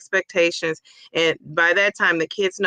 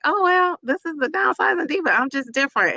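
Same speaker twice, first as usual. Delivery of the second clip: higher, sassy diva voice; sassy self-talk; 7 semitones higher than usual. Retold or imitated. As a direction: shrug it off like a diva. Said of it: "then I think".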